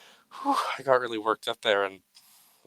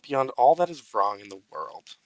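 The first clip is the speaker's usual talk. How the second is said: mock solemn tribute. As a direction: give a grand, mock-serious speech.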